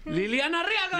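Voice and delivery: very high, excited shout of the name; announcer intro. Real, not acted. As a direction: hype up the guest's entrance. Announcing the guest.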